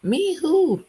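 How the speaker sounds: high, loud, baffled grandma. Retold or imitated. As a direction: bark it out like a grandma who doesn't recognize you. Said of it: "she's like".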